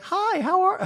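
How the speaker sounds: very high, loud squeaky greeting; a badly attempted Jennifer Tilly. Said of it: "I wish I could do a Jennifer Tilly voice".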